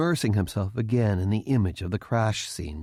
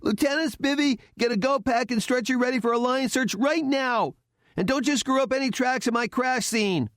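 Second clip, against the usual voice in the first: higher barked command to the lieutenant; shouted order; 14 semitones higher than usual. A narrator's reading. Cadet takes charge, shouting orders at the lieutenant. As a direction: take charge with a sharp called order. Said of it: "then shouted".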